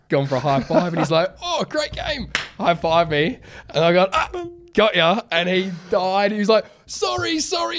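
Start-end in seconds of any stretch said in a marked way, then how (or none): 1.4-2.3 much higher, light, friendly reply; a naive guard caught off guard; imitating guard. Security guard returns high five. 4.1-5.2 higher, louder triumphant gotcha; spring the trap with glee; gleeful gotcha. Retold or imitated. Catching the guard out. 6.9-7.8 very high, flustered repeated sorry; a flustered guard squeaking apologies; mock panicked apology. Guard panics after high five.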